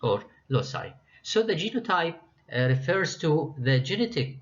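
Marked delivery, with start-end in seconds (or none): 0.5-0.9 loci said LOH-sai; "loci" as LOH-sai, rhyming with sigh; soft-c pronunciation. Shown as a demonstration. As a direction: say loci with an s sound and an "eye" ending. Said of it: "can be pronounced as".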